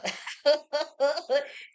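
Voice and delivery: a loud, high burst of laughter; loud cackle. Real, not acted. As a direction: cackle at your own punchline. Laughing at own joke.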